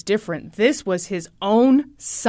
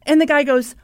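ordinary talk

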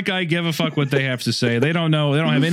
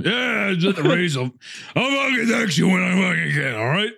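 hammed-up, higher-pitched mock texting voice; 4 semitones higher than usual. Co-host parodies his own texts to a friend, mock texting voice. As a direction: play your own texts as a curt, put-on character. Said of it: "what I sound like in text form".

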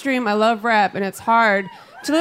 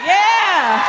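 high, loud drawn-out cheer; triumphant yell; 9 semitones higher, 5 dB louder than usual. Retold or imitated. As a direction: cheer it like a victory. Reenacts her triumphant reply.

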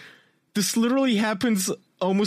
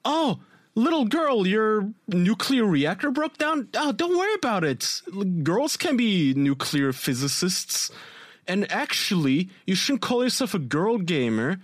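high sweet influencer voice; 4 semitones higher than usual. Retold or imitated. Voicing Sniper Wolf to girl.

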